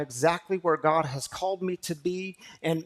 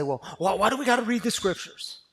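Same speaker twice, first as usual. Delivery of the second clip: mock skeptic; 6 semitones higher, 7 dB louder, faster than usual. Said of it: "Some people will say".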